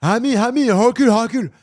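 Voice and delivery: put-on shouted, high-pitched Brazilian-accented call, R as H; loud caricatured Brazilian accent, urgent calls; mock Brazilian accent. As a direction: holler it like a Brazilian teammate. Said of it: "j'ai joué avec des Brésiliens".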